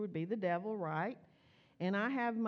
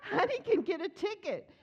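much higher and louder, scolding through laughter; laughing scold; 10 semitones higher, 5 dB louder than usual. Retold or imitated. Mother telling child off while laughing. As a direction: try to be stern but crack up. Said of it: "So I'm like".

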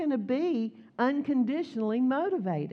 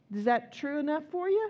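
ordinary talk, nothing marked

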